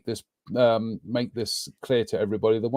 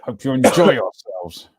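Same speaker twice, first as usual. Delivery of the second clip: forced words through clenched teeth; grudging, teeth clenched tight; through gritted teeth; 5 dB louder, much slower than usual. Pretend. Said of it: "He said through clenched teeth".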